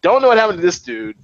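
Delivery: ordinary delivery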